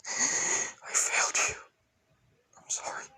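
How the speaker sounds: acted tearful whisper; crying. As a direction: whisper a broken apology as if crying. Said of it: "With him crying".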